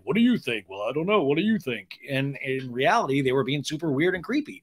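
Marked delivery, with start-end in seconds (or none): 0.0-2.0 lightly voiced silly chatter; swooping, mildly goofy imitation; silly drunk-friend voices. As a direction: put on a slightly silly drunk-pal voice. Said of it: "they were doing voices like".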